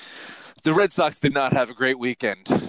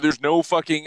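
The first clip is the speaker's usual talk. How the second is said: ordinary talk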